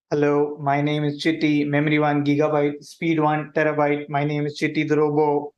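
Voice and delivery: usual speaking voice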